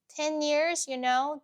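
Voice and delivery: ordinary delivery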